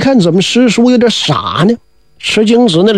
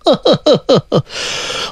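drowsy chuckle; 3 semitones higher, 3 dB quieter than usual. Acted. Waking from deathlike sleep.

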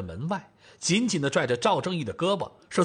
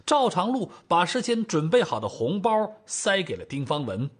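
usual speaking voice

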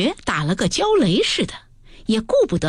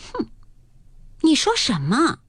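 sudden cold anger; 6 semitones higher than usual. A narrator's reading. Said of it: "林黛玉顿时撂下脸来".

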